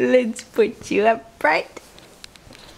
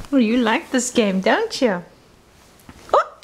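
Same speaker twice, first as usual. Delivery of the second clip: normal talking voice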